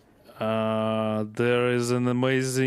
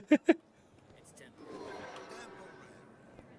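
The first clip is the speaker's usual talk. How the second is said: wheezy voiceless laugh; breathless silent laugh; 10 semitones higher, 12 dB quieter than usual. Real, not acted. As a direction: laugh without voice, all breath.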